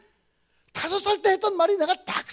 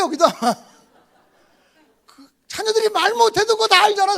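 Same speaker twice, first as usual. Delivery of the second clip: ordinary talk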